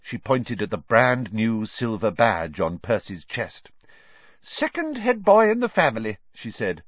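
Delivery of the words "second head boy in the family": high, louder motherly boast; proud. A narrator's reading. Mother announcing Percy's badge, swelling with pride. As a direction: show off your son's achievement. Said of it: "swelling with pride".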